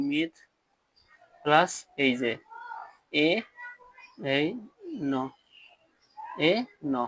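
মিথ প্লাস এ ন said piece by piece with long pauses; broken into sounds. Shown as a demonstration. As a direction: sound out each part separately. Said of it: "একটু ভাঙিয়ে দেয়". (ভেঙে ভেঙে উচ্চারণ)

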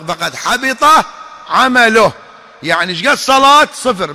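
loud, fervent preacher's cry; preacher warns the faithful their deeds are void, crying out; preaching at full force. Acted. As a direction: drive it home to the congregation. Sermon climax on lost deeds.

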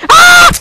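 loud high startled yell; real shout. Real, not acted. A player yells as his runner dies. Sudden fail in the game.

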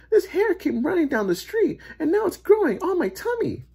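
high-pitched frantic complaint; distressed lady. A narrator's reading. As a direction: tell it in a high lady's voice.

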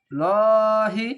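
লহি drawn long and loud; tajweed elongation demo; heavy stretched recitation. Shown as a demonstration. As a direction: hold the vowel of লহি full and heavy. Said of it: "খাড়া জবর একালিফ টেনে পড়বেন".

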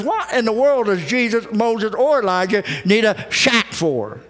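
usual speaking voice

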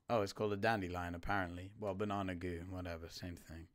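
usual speaking voice

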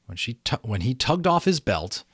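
usual speaking voice

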